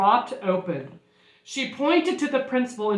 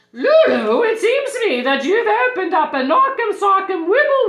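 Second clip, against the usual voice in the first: high, loud, dramatic pronouncement; big, raised storybook nurse voice; nurse declaring; 7 semitones higher, 8 dB louder than usual. Acted. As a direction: proclaim it like a startling diagnosis.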